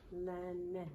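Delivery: "Lemon" as two soft, separate beats; split into syllables. Shown as a demonstration. Teacher confirming two syllables, "Lemon" softly split in two. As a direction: softly repeat "Lemon", one syllable at a time. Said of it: "Two syllables".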